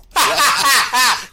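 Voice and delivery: loud, high real laughter; loud laughing whoop; laughing shout. Real, not acted. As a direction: burst out after the impression. Laughs after the imitation.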